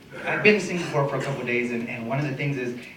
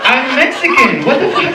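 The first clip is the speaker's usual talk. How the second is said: indignant yell; 12 semitones higher, 12 dB louder than usual. Retold or imitated. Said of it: "comic retorting to workers".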